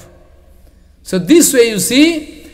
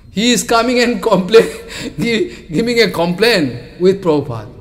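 ordinary talk, nothing marked

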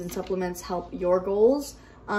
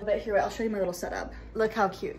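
ordinary talk, nothing marked

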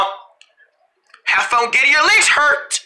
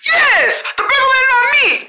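much higher, triumphant yell of joy; excited cheer. Real, not acted. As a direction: shout it like you just won. Pencil picks her as boss.

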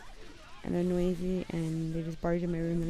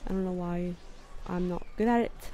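put-on amateur London accent, done for fun; playful, imperfect London accent. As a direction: talk like a Londoner out of Harry Potter. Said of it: "a London accent".

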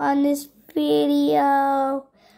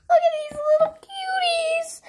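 much higher, fast, doting coo; 13 semitones higher, faster than usual. Real, not acted. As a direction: gush over tiny cute things. Cooing at tiny toys.

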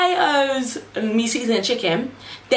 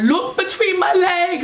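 demanding; 10 semitones higher, slower than usual. Retold or imitated. Mother in labour demanding the midwife look, high and slow. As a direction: insist she look, urgently. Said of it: "I'm like to her".

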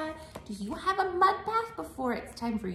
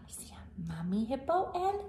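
quick hushed aside; whisper; 3 semitones lower, 5 dB quieter, faster than usual. Real, not acted. Teller whispers aside to children.

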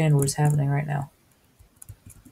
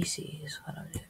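sneaky whisper; 18 dB quieter than usual. Real, not acted. Sneaking in game.

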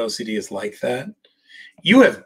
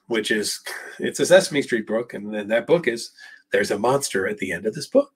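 ordinary delivery

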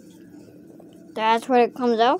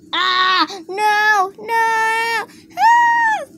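high drawn-out play scream; mock panic; 10 semitones higher, much slower than usual. Toy woken to zombies, high mock-panicked wailing. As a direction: wail in pretend terror, climbing to a squeal.

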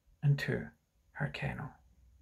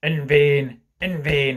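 loud, declamatory refusal; cried out; 6 dB louder, slower than usual. Acted. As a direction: cry it out with stress on each "vain". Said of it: "he cried".

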